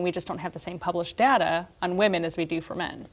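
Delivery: ordinary talk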